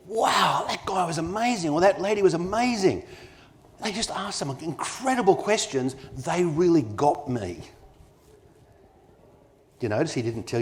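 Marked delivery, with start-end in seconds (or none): 0.1-7.6 high, bright-eyed awe, slowing at the end; impressed client gushing. Retold or imitated. Client leaving a great meeting, gushing in raised-pitch awe. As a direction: gush about the brilliant advisor. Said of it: "and you go".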